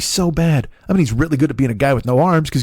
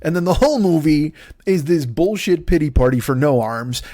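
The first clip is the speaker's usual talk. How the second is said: ordinary talk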